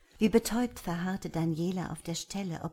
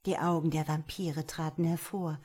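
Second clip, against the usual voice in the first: ordinary delivery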